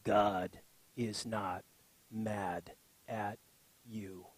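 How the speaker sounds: put-on deep, very slow old preacher; low, measured tough old man impression; Steve Brown. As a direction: drop low and space out the words, fading away. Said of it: "his tagline for his ministry is".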